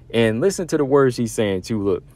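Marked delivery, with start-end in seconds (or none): none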